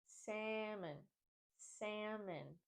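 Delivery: salmon softly as SAM-un, silent L; A as in cat. Shown as a demonstration. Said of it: "The A in salmon is pronounced like the A in cat".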